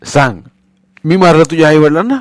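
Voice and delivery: demanding, loud rising question; angry shout. A narrator's reading. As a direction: demand an answer, pitch climbing. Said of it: "पुन्हा तो ओरडला".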